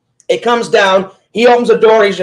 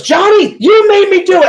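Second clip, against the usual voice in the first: much higher-pitched mock outcry; imitated indignant cry; 11 semitones higher, 3 dB louder than usual. Britney to Johnny after the launch, imitated high-pitched protest.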